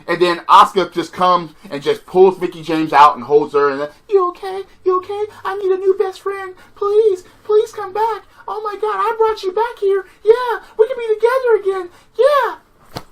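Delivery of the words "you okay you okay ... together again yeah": very high, whimpering fake-tearful voice; mock whiny imitation. Mocking Asuka begging Mickie James, high and whiny. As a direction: ham up a clingy, pleading friend.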